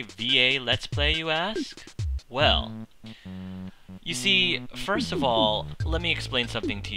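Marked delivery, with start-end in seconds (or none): none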